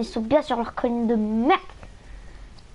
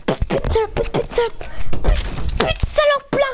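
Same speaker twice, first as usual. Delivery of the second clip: furious swearing; 11 semitones higher than usual. Real, not acted.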